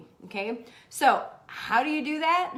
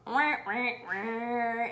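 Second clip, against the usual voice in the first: imitated sad trombone; deflating wah-wah sound; much slower than usual. Host mimicking life spoiling the plan, comic deflation. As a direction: voice a comic let-down noise.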